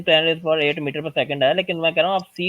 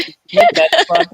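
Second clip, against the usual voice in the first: excited; 13 semitones higher, 8 dB louder, slower than usual. Real, not acted. Excited aside in debate.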